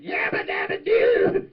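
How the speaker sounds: loud, drawn-out yabba dabba doo; Fred Flintstone cheer. Retold or imitated. Flintstones catchphrase shouted out.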